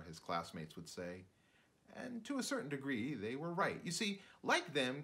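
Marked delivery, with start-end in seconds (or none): none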